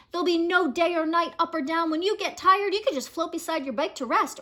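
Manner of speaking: plain reading voice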